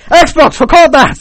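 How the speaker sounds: sudden loud, high yell; screamed command. Retold or imitated. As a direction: bellow it over everyone's silence. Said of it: "I was, like, screaming up".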